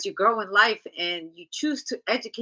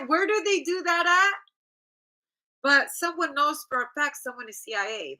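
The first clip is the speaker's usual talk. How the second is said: ordinary delivery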